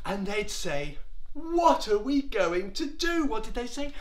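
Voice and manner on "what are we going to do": high, lightly panicked wail; a touch of helpless worry; mild acted dismay. Frightened Israelites voiced in the story.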